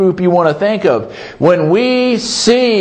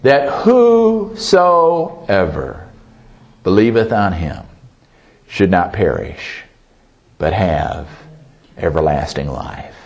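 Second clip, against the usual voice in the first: scripture recitation; 8 semitones lower than usual. Acted. Preacher reciting John 3:16 to the church, reverent fade.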